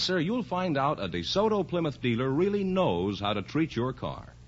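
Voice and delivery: usual speaking voice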